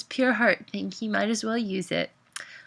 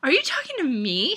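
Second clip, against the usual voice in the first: higher-pitched incredulous question; disbelief; 7 semitones higher than usual. A narrator's reading. Stunned Mariana questioning the movie guy, disbelieving. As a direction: ask it as if you can't believe it. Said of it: "she asked in disbelief".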